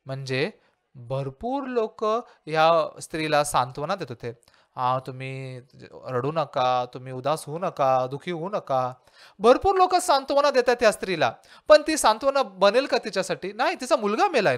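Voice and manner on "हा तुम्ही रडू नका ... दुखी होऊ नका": soft, slow, soothing comfort; softer, drawn-out words of comfort; consoling neighbours. Retold or imitated. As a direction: gently hush a grieving mother. Neighbours consoling the grieving widow.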